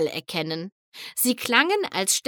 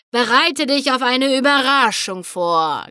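cackling voice; 3 semitones higher, 5 dB louder than usual. A narrator's reading. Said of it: "gackerte die Stimme".

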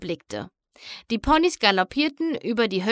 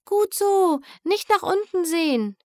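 high, bright encouraging call; cheering on; 8 semitones higher than usual. A narrator's reading. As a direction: cheer them across the rope. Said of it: "feuerte sie an".

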